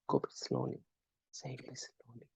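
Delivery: whispered, slow and soft; slow soft demo. Shown as a demonstration. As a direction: breathe it out gently to show the pace. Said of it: "So vary the pace".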